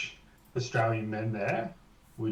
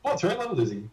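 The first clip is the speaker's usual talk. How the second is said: much higher put-on question; put-on announcer voice; 8 semitones higher than usual. Aussie asks threat level in silly voice. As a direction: ask in a silly voice. Asking about game miniature.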